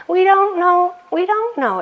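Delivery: high, earnest not-knowing; open wonder. Real, not acted. Contrasting wonder.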